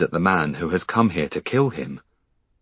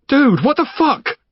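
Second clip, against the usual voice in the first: much higher startled cry; shocked; 4 dB louder than usual. Acted. Finn shoved by a stranger, shocked. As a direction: caught off guard by an intruder.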